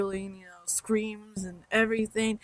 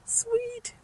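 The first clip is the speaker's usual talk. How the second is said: squealed sweet; 6 dB quieter than usual. Retold or imitated. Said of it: "We're like".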